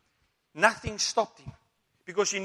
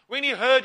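higher, louder "heard"; emphatic; 3 semitones higher, 8 dB louder than usual. Shown as a demonstration. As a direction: punch the word "heard", rising. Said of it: "preacher repeats for emphasis".

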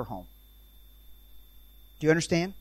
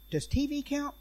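high, small worried "Does T V count?"; timid question pitched way up; anxious mother; 12 semitones higher than usual. Retold or imitated. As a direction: pipe it up like a nervous mom. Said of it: "She goes".

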